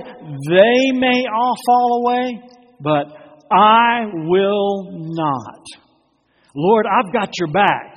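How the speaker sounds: loud, high, boastful protest; proud boast. Acted. Peter boasting to Jesus, loud and proud. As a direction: puff up and brag to the Lord. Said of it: "a little bit of pride in Peter".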